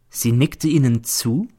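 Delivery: usual speaking voice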